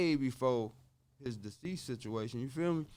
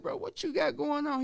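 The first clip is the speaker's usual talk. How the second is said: much higher, louder, demanding an answer; retold exasperated question; 14 semitones higher, 4 dB louder than usual. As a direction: press the partner for an explanation. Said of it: "and I'm like".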